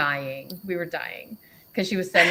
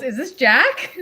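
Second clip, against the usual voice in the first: higher, louder, through laughter; laughing read-out; 5 semitones higher, 6 dB louder, slower than usual. Retold or imitated. Reading her reply to friends, laughing. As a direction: read her guess out while cracking up.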